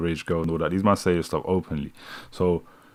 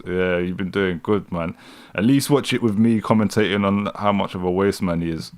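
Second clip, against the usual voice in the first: normal talking voice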